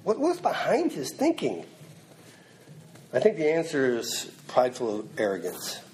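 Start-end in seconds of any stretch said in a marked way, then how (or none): 0.0-1.6 high, wondering question; puzzled question. Retold or imitated. Preacher wondering aloud about Peter's thinking, high and puzzled. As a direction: ask it as if truly puzzled. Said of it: "I said".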